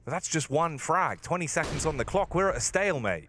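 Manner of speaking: rising excitement, quick and high; esports caster. Real, not acted. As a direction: call the clutch like a caster. Tense clutch play-by-play.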